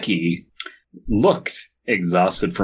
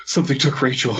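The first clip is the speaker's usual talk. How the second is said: winded, higher voice with audible breaths; out of breath from running; wheezing; 5 semitones higher, faster than usual. A narrator's reading. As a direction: gasp it out as if panting hard. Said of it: "he wheezed out".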